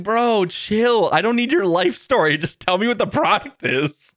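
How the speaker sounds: high, fed-up mock scolding; exasperated. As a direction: tell the ad to chill, annoyed. Annoyed retort to the ad.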